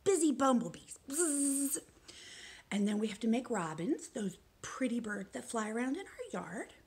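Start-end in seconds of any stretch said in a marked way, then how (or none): 1.1-1.8 short bee buzz; a quick buzz like a bee. Shown as a demonstration. Teacher shows kids the bee motion, buzzing. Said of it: "you have to really do that one".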